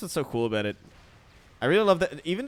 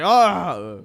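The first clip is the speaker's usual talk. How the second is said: sudden loud, high "Ah!" yell; aggressive yell; 6 semitones higher, 11 dB louder than usual. Shown as a demonstration. Showing friends what an aggressive person says, aggressive yell. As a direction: burst out with a wordless attacking shout. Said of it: "Yeah, they would say".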